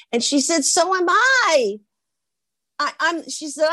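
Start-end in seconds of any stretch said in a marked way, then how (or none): 0.7-1.8 high, slow, singsong reply; exaggerated retold enthusiasm; drawn-out perky echo. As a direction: stretch it out with eager delight. Other girl's reply, repeated slower.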